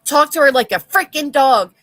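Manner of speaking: loud indignant rant; loud outraged complaint; angry outburst. Real, not acted. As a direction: let the anger out loud. Angry rant about treatment.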